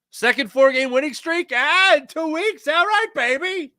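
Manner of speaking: loud, high-pitched cheer with stretched words; excited hype. Real, not acted. Host hyping fans about a winning streak, excited. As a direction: whoop it up, drawing out the last words. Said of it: "Let's get excited about that".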